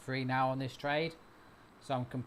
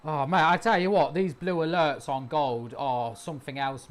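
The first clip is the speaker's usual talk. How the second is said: excited boast; 8 dB louder than usual. Real, not acted. Celebrating winning trade.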